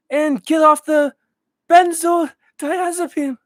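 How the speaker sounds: very high, loud, slow put-on voice; high loud mock lecture; impression of a dating coach. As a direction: lecture slowly in a squeaky caricature. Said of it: "what other impressions should I make".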